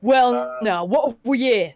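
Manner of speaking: a hint of a rough city guard; gruff guard. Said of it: "says a gruff voice".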